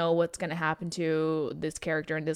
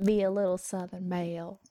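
Southern belle; 3 dB quieter than usual. Acted. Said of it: "just channel your inner Lemon".

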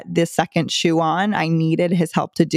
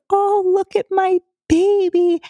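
put-on high sing-song baby talk to a kid; mother coaxing her son with his shoes; high-pitched motherese; 9 semitones higher than usual. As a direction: coo over him like a little baby. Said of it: "I did the motherese. I did the high pitch".

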